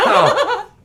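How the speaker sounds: high burst of laughter; loud laugh. Real, not acted. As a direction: crack up loudly. Laughs at shared pic.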